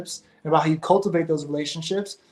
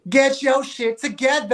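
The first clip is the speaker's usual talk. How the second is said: higher, slow, mock-stern telling off; stern mentor scolding; 8 semitones higher, 3 dB louder than usual. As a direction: call him out like a tough producer. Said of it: "what'd he say".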